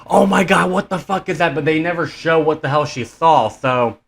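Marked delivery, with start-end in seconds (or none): 0.1-1.6 half-hearted imitation of a panicked scream; mock scream. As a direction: quote a screaming woman in a raised pitch. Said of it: "Heather screaming".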